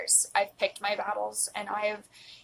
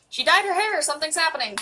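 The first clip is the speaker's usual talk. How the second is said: mock excitement; 8 semitones higher, 5 dB louder than usual. Teasing herself about new look.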